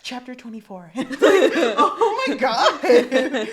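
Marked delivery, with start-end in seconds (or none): 1.8-3.5 loud laughing mock shock; laughing exclamation. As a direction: draw it out loudly through laughter. Laughing reaction to chapter cliffhanger.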